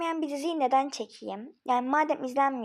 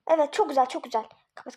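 ordinary talk, nothing marked

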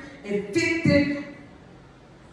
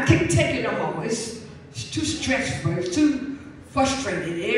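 low, slow, worn-out sigh of a prophet who's had enough; weary Elijah; 5 semitones lower than usual. Acted. Preacher voicing Elijah, exhausted under the juniper tree, low and weary. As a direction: let the words sink, drained.

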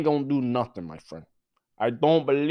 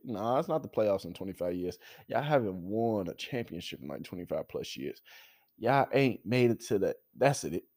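ordinary talk, nothing marked

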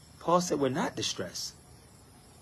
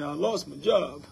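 mock complaining believer; 6 semitones higher than usual. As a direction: ham up a griping churchgoer listing woes. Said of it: "So when you're saying".

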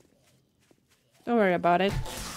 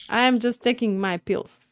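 ordinary delivery

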